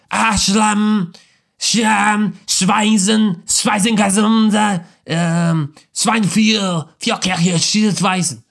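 mock Hitler. Preacher mocking Hitler to his congregation, in fake German gibberish. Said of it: "I'm pretty sure that's how he sounded".

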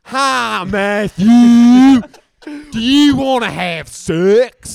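loud, high hillbilly caricature. Pretend. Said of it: "this is what your family's like".

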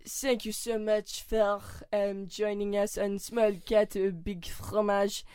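hammed-up French accent, halting. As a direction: fumble grandly through a mock French sign-off. French-accented sign-off in accent game.

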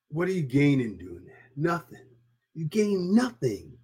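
ordinary talk

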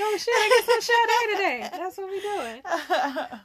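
high, loud and cracking up with laughter; laughing mock surprise. Co-host reacting to switched names, laughing mock surprise. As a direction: squeal it through laughter.

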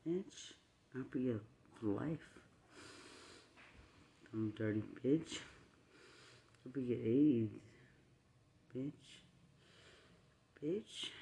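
very soft, low insults with long gaps; quiet cursing. Real, not acted. Cursing an opponent after screaming, very quietly. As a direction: curse them under your voice, barely audible.

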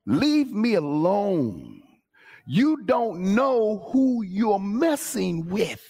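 slow, drawn-out warning; disrespected man's warning. Retold or imitated. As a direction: imitate a pushed man warning someone off, word by word. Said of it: "What he was crying out".

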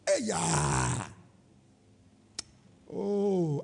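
ordinary talk, nothing marked

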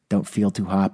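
normal talking voice

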